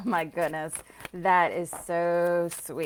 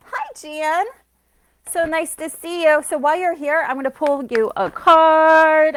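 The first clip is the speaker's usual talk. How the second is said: bright, loud welcome, pitched much higher; loud, high, happy hello to a new arrival; excited greeting; 9 semitones higher, 15 dB louder than usual. Real, not acted. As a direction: greet a friend who just joined, all delight. Greeting a new viewer joining live.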